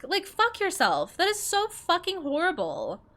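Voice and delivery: high, slow, furious disbelief; real outrage pitched high and slow; angry outburst. Real, not acted. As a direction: spit it out, appalled. Furious rant at self-centered people.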